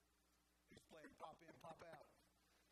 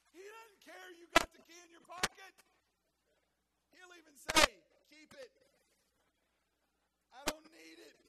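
preaching at full force; 12 semitones higher, 23 dB louder than usual. Acted. Pastor shouting to the congregation, full-force preaching. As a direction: build the list to a shout.

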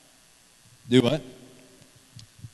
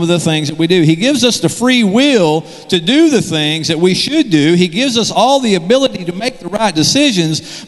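higher and louder, pressing each point; fired-up preaching; 5 semitones higher, 5 dB louder than usual. Acted. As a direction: preach it hard to the church. Preaching at full force.

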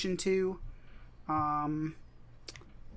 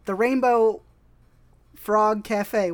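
news anchor; 3 semitones higher, 4 dB louder, slower than usual. Pretend. Mock news anchor opening.

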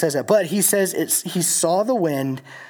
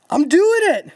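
acted-out elated yell, high and loud; excited Peter; 14 semitones higher, 6 dB louder than usual. Peter thrilled to be walking on water, excited whoop. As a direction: cry it out high like you can't believe it works. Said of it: "he is so excited".

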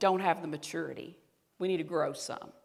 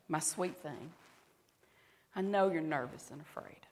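tender whispering God; 2 semitones lower, 7 dB quieter than usual. Acted. God comforting a fearful, anxious woman, tender and hushed. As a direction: soothe softly, close to a whisper. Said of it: "God who whispers over me".

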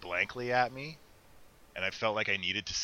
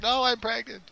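pregnant woman voice; 14 semitones higher, 7 dB louder than usual. Retold or imitated. Fat-shamed pregnant woman retorts.

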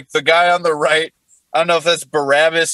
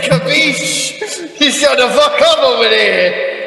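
higher, hammed-up Brooklyn wise guy; Brooklyn accent; 5 semitones higher than usual. Thief scolding whining Jesus from the next cross, Brooklyn accent. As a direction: holler it like an irritated Brooklyn tough. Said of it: "he's fucking Brooklyn. He's like".

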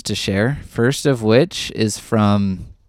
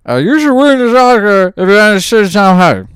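much higher and louder put-on character voice; Tom Hardy's Revenant character; 10 semitones higher, 11 dB louder than usual. Ten word review given in character, much higher and louder put-on voice. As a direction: bellow it out as the rugged frontiersman. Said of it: "as Tom Hardy's character".

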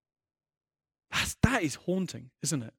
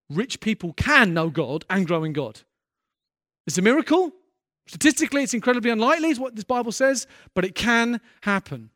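loud, higher, pressing every phrase; impassioned preaching; 5 semitones higher, 8 dB louder than usual. Real, not acted. Preacher urging the church not to numb their hearts, loud and fervent. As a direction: drive the hope home to the room.

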